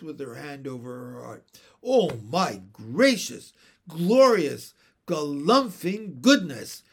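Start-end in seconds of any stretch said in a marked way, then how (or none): 1.8-6.8 loud, punched roar of amazement. A narrator's reading. Mister Wig overcome with laughter, roaring. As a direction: boom out each exclamation. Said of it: "roared Mister Wig".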